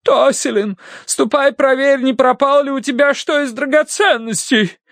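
high, urgent matronly voice; frantic mother. A narrator's reading. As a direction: send her off in alarm. Mother frantically orders daughter.

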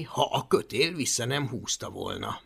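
ordinary delivery

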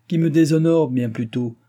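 put-on old man bursting out against his son's praise of the princess; indignant outcry. A narrator's reading. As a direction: fling it back at him in protest. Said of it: "s'écria le vieillard".

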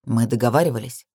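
low, cool reproach; lightly voiced man, turning cold; cold male voice. A narrator's reading. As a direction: let the voice turn cool. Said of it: "Голос его похолодел".

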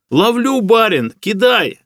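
high, eager holler back; joyful shout. A narrator's reading. Trapped hunter answers his master from below, joyful shout. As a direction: shout up gladly to your rescuer. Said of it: "радостно закричал в ответ Матвей".

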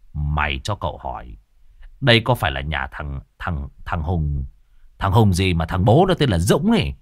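low, rough-edged middle-aged man; Cậu Sáu's voice. Acted. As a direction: drop low as the wandering sorcerer. Cậu Sáu asks.

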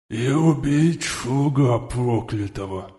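slow lisping lizard voice; slushy lisp. A narrator's reading. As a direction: lisp the words slowly like a reptile. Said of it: "обладающий каким-то шепелявым голосом".

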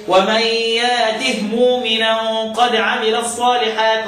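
ترتيل بطيء بهاء ساكنة في "يأته"; إبدال الهمزة مدًا في "يأته" مع تسكين الهاء; قراءة السوسي بالإسكان. Shown as a demonstration. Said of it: "هو الوحيد اللي هيسكن الهاء". (رتّل على مهل وسكّن هاء "يأته")

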